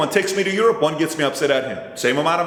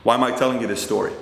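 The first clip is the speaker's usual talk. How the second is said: usual speaking voice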